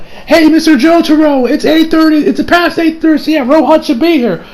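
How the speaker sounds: high-pitched, earnest report; eager character voice. Retold or imitated. Retelling Koichi telling Jotaro the time, high eager voice. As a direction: pitch it well up, calling to an elder.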